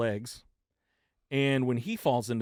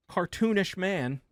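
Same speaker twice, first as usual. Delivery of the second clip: normal talking voice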